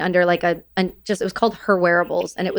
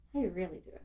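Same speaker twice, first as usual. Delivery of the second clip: intimate close question; 2 semitones lower, 18 dB quieter, faster than usual. Retold or imitated. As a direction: ask it gently, close to the ear. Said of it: "leans in real close ... looks into your soul".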